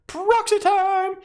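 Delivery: very high, drawn-out cheer; excited call-out. Real, not acted. Host urges viewers to print stand-ins, excited high call. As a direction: sing out the announcement, high and slow.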